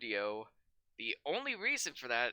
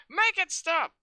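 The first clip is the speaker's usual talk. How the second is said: loud, high hammed-up plea; over-the-top cry to end the rant; mock desperate cry; 10 semitones higher, 7 dB louder, slower than usual. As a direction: scream it like you're at the end of your rope. Climax of copypasta reading.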